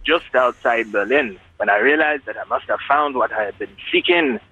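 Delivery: hammed-up Trinidadian storytelling lilt; put-on Trinidadian accent. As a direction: fake a Jamaican-Trinidadian accent. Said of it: "as kind of Jamaican stroke Trinidadian accents go".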